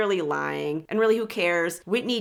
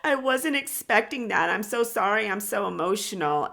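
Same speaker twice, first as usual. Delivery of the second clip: mock crying; 2 semitones higher than usual. Host mimicking a crying woman apologizing to her date, mock tearful. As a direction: imitate a tearful apology, hammed-up. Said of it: "And she's crying".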